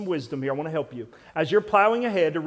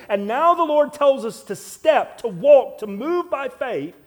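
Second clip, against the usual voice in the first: loud, higher, slow, fervent sermon climax; preaching at full force; 5 semitones higher, 5 dB louder than usual. Acted. Pastor rousing the congregation about plowing forward, loud preaching. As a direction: hammer it home to the congregation.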